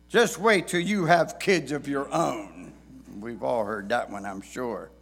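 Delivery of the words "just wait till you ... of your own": knowing dad voice. Retold or imitated. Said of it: "words that many dads have said".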